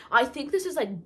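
ordinary delivery

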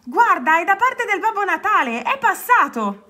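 high, thrilled announcement; breaking the news with glee; excited squealing. A narrator's reading. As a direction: burst with news of a present. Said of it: "strillò Rana".

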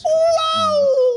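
high, loud cry of disbelief; shocked wow. Real, not acted. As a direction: yelp it out in shock. Shocked at the player spin.

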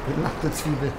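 ordinary delivery

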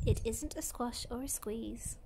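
hushed whispered ending. Acted. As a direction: whisper the last line softly. Whispered closing line.

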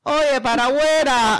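loud, high mock Cuban telling-off; playful Cuban holler; Cuban accent insult. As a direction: shout them out in a hammed-up Cuban accent. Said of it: "Insult someone in your best Cuban accent".

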